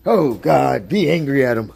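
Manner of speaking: loud, mocking go-get-em; loud sarcastic egging. Real, not acted. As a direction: sarcastically urge someone to go fight.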